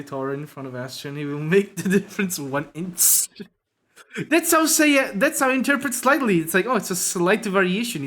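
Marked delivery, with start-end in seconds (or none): 3.8-8.0 high and loud through laughter; laughing. Real, not acted. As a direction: laugh while explaining. Laughing, mocking interpretation.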